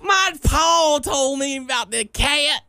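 high, slow shouted country twang; mock hillbilly. Mocking country characters.

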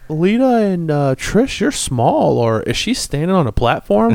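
high and loud, stunned; excited disbelief. Retold or imitated. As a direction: exclaim at the screen in disbelief. Loud amazed rant at screen.

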